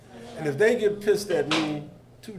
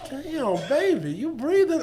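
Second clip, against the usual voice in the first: mock surprise; 10 semitones higher, slower than usual. Retold teasing question to student.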